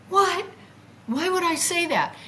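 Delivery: high, startled self-questioning; retold disbelief. She reacts to her own strange plea, incredulous. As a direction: question yourself in high-pitched astonishment.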